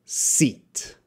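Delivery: long ee in "seat", lips spread wide. Shown as a demonstration. Teacher modelling "seat" as a long sound. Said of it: "practice your listening of".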